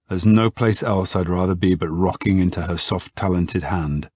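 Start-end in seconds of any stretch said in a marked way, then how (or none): none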